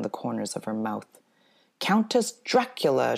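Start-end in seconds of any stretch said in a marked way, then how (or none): 1.8-3.2 much higher and louder, grandly announced; showy vampire name. Retold or imitated. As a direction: present the name like a proud little countess. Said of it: "she called herself".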